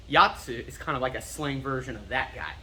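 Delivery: ordinary talk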